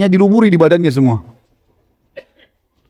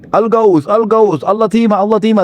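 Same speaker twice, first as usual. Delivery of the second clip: higher mock alarm chant; imitated repeated shouting; 5 semitones higher than usual. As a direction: call it out in a steady run, half force. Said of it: "Teriak-teriak lagi". (tiruan teriakan berulang bernada tinggi)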